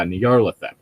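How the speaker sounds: usual speaking voice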